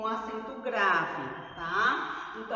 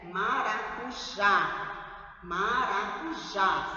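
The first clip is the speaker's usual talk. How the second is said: "Maracujá" slowly, landing hard on "já"; stressed final syllable; much slower than usual. Shown as a demonstration. Teacher showing the tonic syllable, slow "Maracujá" stressed on "já". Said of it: "Sílaba tônica".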